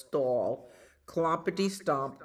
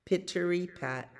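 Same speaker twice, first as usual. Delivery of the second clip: ordinary delivery, nothing marked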